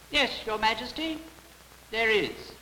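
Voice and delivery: put-on high-pitched little old man. A narrator's reading. Little old man answers the king, high-voiced. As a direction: pipe up politely as a small old man.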